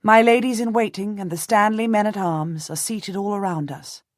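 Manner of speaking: plain reading voice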